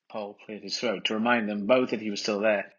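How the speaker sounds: ordinary delivery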